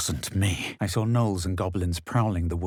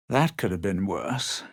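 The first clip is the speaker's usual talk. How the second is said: usual speaking voice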